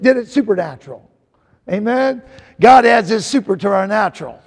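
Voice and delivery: normal talking voice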